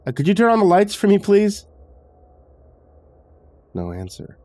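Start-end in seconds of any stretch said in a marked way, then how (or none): 0.1-1.7 high, quick, nervous request; hesitant request. A narrator's reading. Visitor asks the unseen woman for light, hesitant and high. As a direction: ask hurriedly, pitched up and louder. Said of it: "I hesitated".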